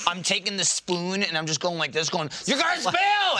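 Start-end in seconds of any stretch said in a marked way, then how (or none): 2.5-3.4 very high pestering yell; mock screaming. Screaming at her while shaking the spoon, mock alarm. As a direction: shriek it high to torment her. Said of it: "I'm screaming at her".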